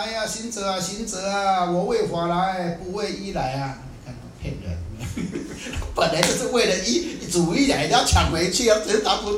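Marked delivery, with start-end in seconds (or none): 0.0-3.8 calling out. Retold or imitated. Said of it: "就开始喊了". 4.8-5.9 quiet laugh. Real, not acted. Laughs at the monk's lie.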